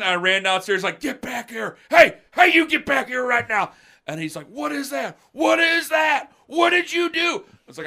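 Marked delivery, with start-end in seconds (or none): 1.0-3.7 put-on high, loud call after a fleeing kid; imitated dad shouting. Dad calling back his escaping son, angry retold shout. 4.5-7.4 high shouted demands, growing louder; imitated irate dad. Dad demanding answers about the burn, mock-furious shouting. Retold dad interrogating son.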